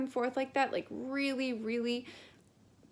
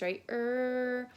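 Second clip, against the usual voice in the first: normal talking voice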